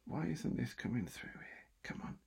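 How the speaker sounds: frustrated whisper. Real, not acted. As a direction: hiss it under your breath. Muttering at broken audio.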